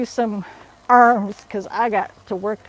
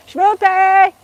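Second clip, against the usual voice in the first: higher, louder call of Shmoopy; 8 semitones higher, 6 dB louder than usual. Real, not acted. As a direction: shout the nickname to bring him over. Calling husband to the camera.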